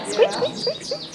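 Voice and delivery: very high, rising squeaks like a squeezed toy; squeaky dog toy. Retold or imitated. Mimicking the goldfinch call for the birders, high toy squeaks. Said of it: "You hear it, right?".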